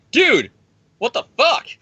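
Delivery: real frustration at the comic; exasperated outburst. Real, not acted. Reacting to the comic.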